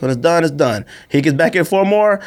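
ordinary delivery

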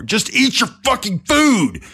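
high, drawn-out yell, re-enacted. Dad pushed further by his crying son, angry yell. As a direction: act out a dad yelling in exasperation. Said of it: "I got even louder".

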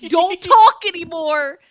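slow, loud, punched-out command; exasperated mock shout; retold yell. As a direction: yell it at the device word by word. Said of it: "I yell at her all the time".

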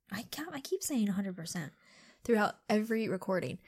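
whispering. Real, not acted. Self-deprecating aside to co-host.

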